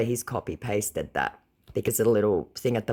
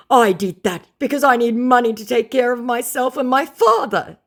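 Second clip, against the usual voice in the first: high, loud whiny voice; whiny scammer; 13 semitones higher, 8 dB louder than usual. Retold or imitated. Scammer pleading his excuse, high and whiny. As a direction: excuse yourself pitifully.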